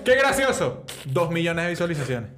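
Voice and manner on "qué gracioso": higher and louder, still giggling; mock fan gushing over a funny clip; laughing viewer. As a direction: repeat it amused and lifted. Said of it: "él se pone así que".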